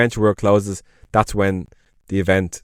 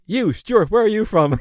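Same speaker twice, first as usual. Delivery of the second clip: retold host calling a guest out, pitched up; playful host call-out, pitched high; 11 semitones higher than usual. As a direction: point at him and tease.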